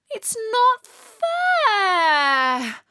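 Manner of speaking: put-on high, loud, very drawn-out childish wail. A narrator's reading. Natalie told she gets no presents, wailing. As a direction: howl it, holding the last word long. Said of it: "wailed Natalie".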